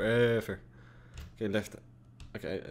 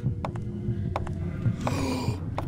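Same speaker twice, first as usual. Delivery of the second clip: frightened gasp; 14 semitones higher than usual. Real, not acted. Monster appears in horror game.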